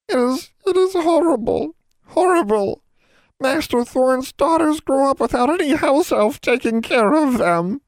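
very high, loud elf voice, blubbering; a tiny elf falling apart in tears; blubbering house elf. A narrator's reading. Old elf blubbering about the neglected daughters. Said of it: "Triss blubbered".

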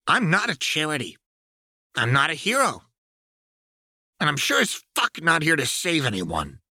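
high, hard, defiant voice; defiant young woman. Acted. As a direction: lay down the terms coldly. Jinx's defiant speech.

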